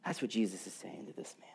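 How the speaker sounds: soft whisper after a deep point; real whisper. Real, not acted. Preacher sums up Jesus's words to the man in a whisper. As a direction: let it fall to a whisper.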